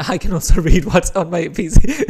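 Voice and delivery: loud, breaking into laughter; laughing. Real, not acted. Streamer joking about the glasses' reflection, laughing. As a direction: talk through a real laugh.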